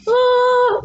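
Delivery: very high sung oh; high-pitched demo oh. Shown as a demonstration. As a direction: hit a high oh. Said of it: "So when somebody goes".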